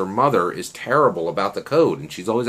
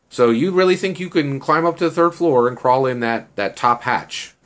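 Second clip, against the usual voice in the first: higher, louder retold doubt; sceptical challenge; 7 semitones higher, 4 dB louder than usual. Girl doubting he can climb to the third floor, sceptical. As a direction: challenge him as the disbelieving girl.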